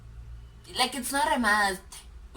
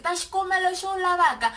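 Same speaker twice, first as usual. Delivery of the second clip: higher, louder imitation of a stock phrase; mock eager quote; 7 semitones higher, 6 dB louder than usual. Someone answering on hearing that I'm Tsonga, mock eager. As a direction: parrot the phrase loudly, pitched up. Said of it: "and you're like".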